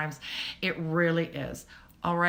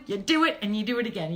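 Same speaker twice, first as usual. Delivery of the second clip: ordinary talk, nothing marked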